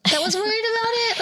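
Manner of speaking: high, fast squeak of disbelief; high, fast mock-indignant question; incredulous squeak. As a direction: fire it off in a high, fast, self-mocking squeak. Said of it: "I'm like".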